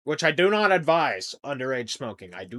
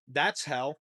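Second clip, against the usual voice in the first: normal talking voice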